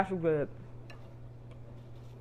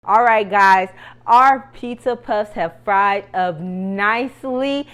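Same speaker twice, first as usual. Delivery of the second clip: loud; 2 semitones higher, 11 dB louder than usual. Real, not acted. Addresses viewers after frying.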